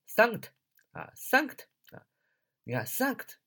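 单独慢慢读出 Sanct，读得像 thank 带 t 尾; 把 Sanct 拆出来带读，尾音 t 点出来; 示范发音. Shown as a demonstration. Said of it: "怎么读啊". (Sanct 作为词的前半段逐遍示范)

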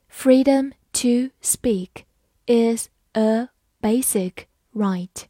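slow, separated words with clear pauses; slow reading. Shown as a demonstration. Teacher reading the example sentence for learners, slowly. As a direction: take it one word at a time, slowly. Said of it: "好，慢慢来读".

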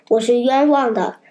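plain reading voice

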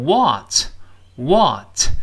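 "what" with a wide open American ah vowel, slow. Shown as a demonstration. Teacher demonstrating the American vowel to the class, wide open. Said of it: "美音中口型大一些".

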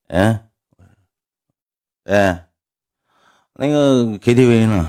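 ordinary talk, nothing marked

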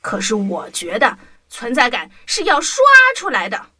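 plain reading voice

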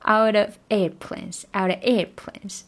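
out of airplanes with air hit hard; stress on air. Shown as a demonstration. Teacher shows air stressed, of swallowed. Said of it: "他为了强调air".

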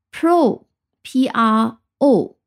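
pro then letters P R O said slowly one by one; spelling out pro. Shown as a demonstration. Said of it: "这个词缀在两个词里面发音是不一样的". (慢速逐个字母拼读)

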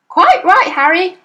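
high and loud, backing her husband; shrill mother siding with husband. A narrator's reading. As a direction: jump in to take his side. Mother eagerly backs husband.

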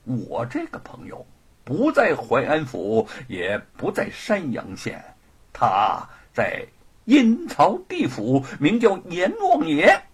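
deliberate, measured delivery; unhurried. Acted. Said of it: "慢条斯理地说".

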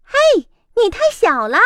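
高而响，凶巴巴; 猫厉声训斥; fierce cat. Acted. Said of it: "猫凶巴巴地说". (猫凶巴巴地训斥鸭子)

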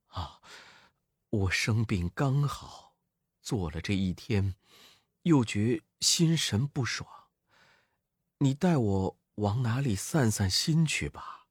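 soft, low and weary; frail monk. A narrator's reading. The recovering monk asks the demon to take him strolling, soft and weary. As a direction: sigh it out, just recovered from illness.

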